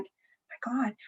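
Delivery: breathless hushed whisper of wonder; awed whisper. Retold or imitated. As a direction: whisper it in awe. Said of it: "they're like".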